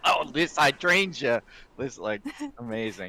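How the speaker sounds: put-on thick Chicago accent. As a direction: stretch hydrangea like a thick Chicago guy. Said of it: "in, like, the thickest Chicago accent".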